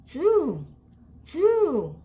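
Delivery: ordinary delivery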